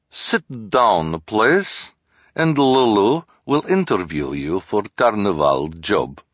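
put-on thick foreign fortune-teller accent; broken-English carnival accent; Madame Lulu accent. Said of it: "Madame Lulu said".

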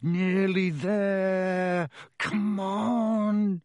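slow, coaxing plea; drawn-out gentle urging; cajoling. A narrator's reading. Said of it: "Rod said cajolingly".

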